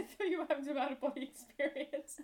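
high giggling exclamation; laughing. Real, not acted. Laughing at friend's sneeze.

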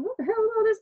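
high, startled "what the hell"; shocked exclamation. Retold or imitated. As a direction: react in disbelief, pitch jumping up. Shocked reaction retold.

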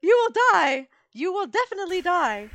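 high, cutesy cartoon squeak; adorable squeaky voice. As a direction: make the threat sound adorable. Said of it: "In a much more adorable way".

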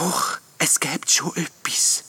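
high, slow, wispy ghost reply; little ghost. A narrator's reading. Little ghost hinting at a remedy, tentatively. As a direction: answer softly in a high ghost voice. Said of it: "gibt der kleine Geist zur Antwort".

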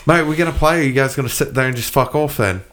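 pushy British-style gamer mate; put-on accent. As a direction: demand it in a mock British accent. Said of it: "that's how I can learn it".

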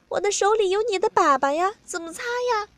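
high-pitched protesting voice; whining objection. A narrator's reading. Partner questions the narrator's demand, whining objection. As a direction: object helplessly, pitched well up.

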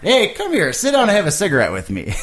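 high comic coach voice; hammed-up, high-pitched lazy trainer; anti-trainer. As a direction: coax the fighter to slack off. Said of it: "he's the anti-trainer".

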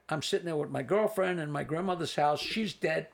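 usual speaking voice

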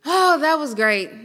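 normal talking voice

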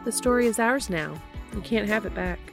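plain reading voice

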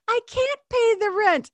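very high, slow helpless damsel. Acted. Cartoon girl tied to the tracks, pleading in a high helpless voice. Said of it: "the girl would go".

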